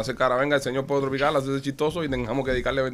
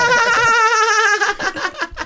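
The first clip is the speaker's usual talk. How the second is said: loud burst of laughter; 5 dB louder than usual. Real, not acted. Bursting laugh at toilet story.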